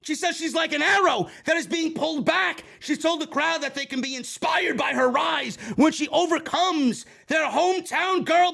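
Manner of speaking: ordinary talk, nothing marked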